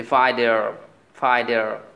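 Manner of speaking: ফাইটার as "fai-der", r sounded; American t as d. Shown as a demonstration. Teacher giving English for warrior, ফাইটার as "faider". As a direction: soften the t of ফাইটার to a d. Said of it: "ট সাউন্ডকে অনেকটা ড করে দেয়".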